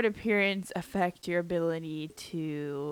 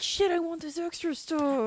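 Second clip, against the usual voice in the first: put-on kid's whine; 9 semitones higher than usual. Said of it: "But then you're like".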